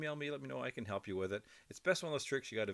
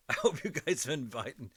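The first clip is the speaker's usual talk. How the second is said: laughing; 5 semitones higher, 7 dB louder than usual. Real, not acted. Laughing after the bag gag.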